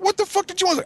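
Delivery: high-pitched mock exasperation; retold exasperated demand. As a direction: demand an answer, voice shooting up. Retold argument with attacker.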